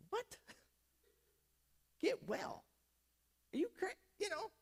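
high, soft, baffled reaction; acted high-pitched disbelief; incredulous man. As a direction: play the man softly taken aback. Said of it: "I think the man went".

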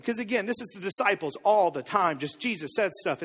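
ordinary delivery

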